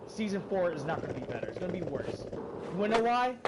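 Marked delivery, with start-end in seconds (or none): 2.3-3.4 challenging question. Real, not acted. Ranting at the game's makers.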